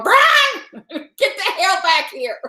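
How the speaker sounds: high laughing yell at the dog. Retold or imitated. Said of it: "your dog going".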